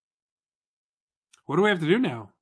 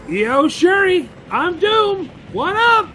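high, loud hailing shout; playful high-pitched announcement; calling out; 12 semitones higher, 4 dB louder, slower than usual. Pretend. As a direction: holler a cocky greeting. Calling out to game character.

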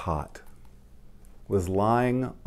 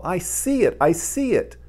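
higher, thrilled repeat; excited cry; 9 semitones higher, 5 dB louder than usual. Acted. Little mole thrilled to see the daffodil, excited cry. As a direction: squeal it with joy. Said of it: "cried little mole".

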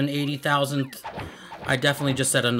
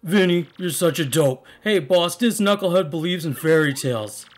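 loud, scornful goon; funny voice; 4 semitones higher, 4 dB louder than usual. Acted. Henchman mocking Vinny to the boss, funny tough-guy voice. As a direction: scoff like a cartoon mob heavy. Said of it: "I wanna do some funny voices".

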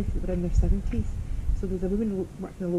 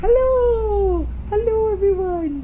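high, loud, drawn-out hello; long squealed doggy greeting; voicing the dog; 13 semitones higher, 8 dB louder, much slower than usual. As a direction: put on a high doggy voice, stretch the vowels. Speaking as the dog Mac.

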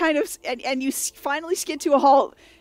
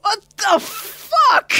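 high, slow, drawn-out exclamation; outraged disbelief; 7 semitones higher, 4 dB louder, much slower than usual. Acted. Someone knocked down in the sled crash, outraged disbelief. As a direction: stretch out each word in disbelief.

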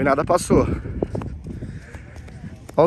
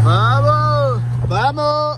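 shouting; 5 semitones higher, much slower than usual. Real, not acted. Cheering the car on.

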